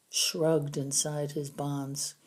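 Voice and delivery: usual speaking voice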